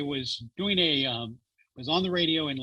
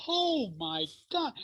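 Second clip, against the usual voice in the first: amazed; 11 semitones higher, slower than usual. Retold or imitated. Stunned at invitation to Pope.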